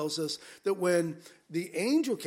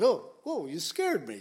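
light raised "Oh, whoa", settling quickly; a casual everyday jump of surprise; half-playful startled apology; mild startle. Retold or imitated. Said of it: "You can say it to strangers".

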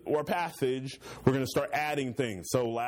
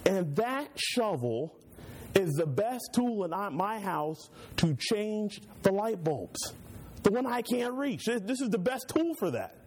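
higher, slow, proudly showing off the tool; proud explanation; 7 semitones higher, slower than usual. Retold or imitated. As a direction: explain it like it makes perfect sense. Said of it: "I'd say".

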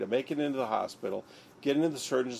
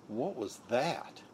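dazed, slow question; 5 dB quieter, much slower than usual. Retold or imitated. Stunned after sudden hit.

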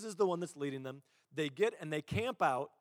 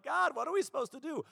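mock pleading; 11 semitones higher, 4 dB louder than usual. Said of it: "we're like".

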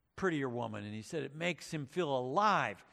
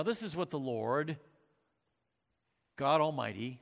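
ordinary talk, nothing marked

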